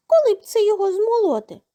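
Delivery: mouse voice. A narrator's reading. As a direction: pipe up like a tiny mouse. Mice characters speaking in tale.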